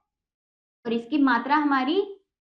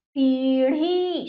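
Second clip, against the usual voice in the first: सीढ़ी slow, both ई held long; drawn-out vowels; much slower than usual. Shown as a demonstration. Teacher reads the word to children, drawn out. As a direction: stretch each syllable of सीढ़ी. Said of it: "हम इसको खींचेंगे".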